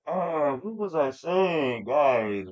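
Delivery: slow, drawn-out wondering; trailing off. Real, not acted. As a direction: stretch it out as you lose the thread. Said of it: "I'm having an old man moment".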